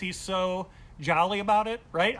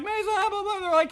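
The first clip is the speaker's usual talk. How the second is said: crowd chatter; 6 dB louder than usual. Retold or imitated. Crowd greeting him mockingly.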